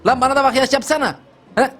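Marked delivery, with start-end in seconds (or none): none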